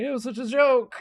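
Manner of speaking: high, exclaimed protest in character; gnomes protesting. Acted. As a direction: exclaim it high, swooping upward. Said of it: "they're like".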